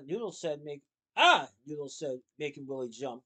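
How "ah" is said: loud, high shout of discovery; sudden cry. A narrator's reading. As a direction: burst out with a startling ah. Noodle's sudden realisation.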